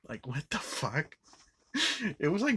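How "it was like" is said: high laugh breaking into words. Real, not acted. Laughing about the scene.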